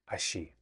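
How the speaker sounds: "ashi" with a soft sh sound; "ashi", the y turned into a hushed sh; Argentine pronunciation. Shown as a demonstration. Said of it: "to pronounce it as".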